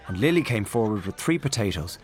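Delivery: ordinary delivery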